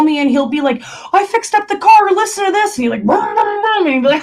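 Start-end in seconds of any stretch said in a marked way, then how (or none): 1.1-2.8 excited dad. Retold or imitated. Imitating excited dad on phone. 3.1-3.9 engine boom imitation. Imitating revving car.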